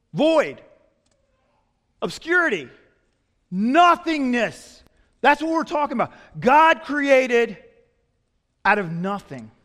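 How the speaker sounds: high, loud, slow pulpit emphasis; preaching at full force. Acted. Preacher stressing creation from nothing, high and loud. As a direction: stretch "Nothingness" out for the congregation.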